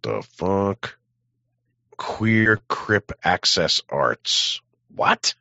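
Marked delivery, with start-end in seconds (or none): none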